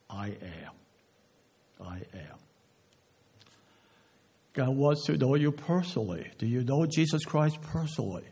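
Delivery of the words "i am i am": very low, soft and solemn; hushed declaration. Acted. Preacher quoting what Jesus declared, hushed. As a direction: let each phrase drop low and quiet.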